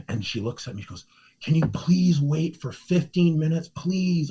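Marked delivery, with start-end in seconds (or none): 1.4-4.3 high-pitched imitated begging. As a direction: plead like her, voice pitched up. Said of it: "Like, just begging me".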